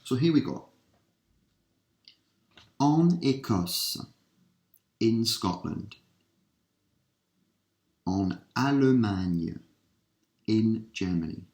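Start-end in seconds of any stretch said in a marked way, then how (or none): 2.8-4.0 "En Écosse" said slowly, the "en" stressed, falling at the end; clear French model. Shown as a demonstration. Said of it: "teacher models French word". 8.1-9.6 "En Allemagne" drawn out slowly, stress on "allemagne", falling; clear French model. Shown as a demonstration. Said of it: "teacher models French word".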